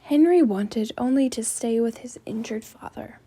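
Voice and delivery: ordinary delivery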